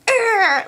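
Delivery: high, loud "urgh"; playful frustrated grunt; mock growl of disgust. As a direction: let out an exaggerated angry growl. Imitates Rosalie's angry reaction.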